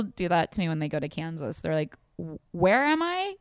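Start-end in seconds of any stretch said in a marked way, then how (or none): 2.2-3.3 high, slow lost-visitor voice; mock bewilderment. Imitating friends who visit Kansas, bewildered. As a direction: draw it out high, utterly baffled.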